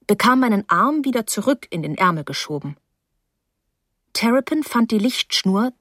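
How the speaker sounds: ordinary delivery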